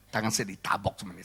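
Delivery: usual speaking voice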